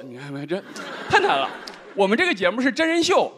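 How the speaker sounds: usual speaking voice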